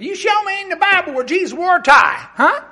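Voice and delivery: high, loud indignant demand; defiant young preacher challenging the farmers; defiant challenge. Retold or imitated. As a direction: challenge them to prove it. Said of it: "And he said".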